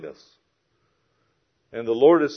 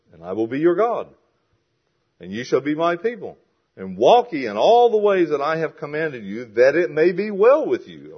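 declamatory reading, building higher and louder; scripture reading; 5 semitones higher, 9 dB louder than usual. Acted. Preacher reads God's promise from Jeremiah, building declamation. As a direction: proclaim the verse with rising force.

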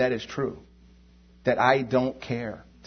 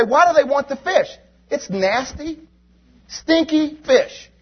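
high, scornful whine about the fish; turning up your nose at nasty fish; disgusted mock; 11 semitones higher, 4 dB louder than usual. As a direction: sneer at the stinky fish. Mocking the grumblers about fish.

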